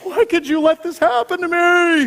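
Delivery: put-on high, loud whimpering little boy; teary little boy. Hurt toddler wails at his dad, high and loud. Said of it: "and he's going".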